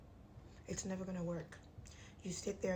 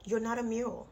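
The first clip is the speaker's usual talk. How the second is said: emphatic; 3 semitones higher, 8 dB louder than usual. Real, not acted. Firm scolding advice.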